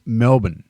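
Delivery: slow Melbourne in two syllables, Mel-bn; Aussie Melbourne. Shown as a demonstration. Showing viewers the Aussie way, slow two-syllable Melbourne. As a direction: swallow the middle of Melbourne, no R. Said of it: "swallow the middle bit".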